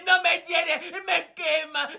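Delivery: sobbed singalong lament; theatrical crying. Acted. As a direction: bawl it out between sobs. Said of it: "váyase al salón a seguir llorando". (llanto dramático y exagerado)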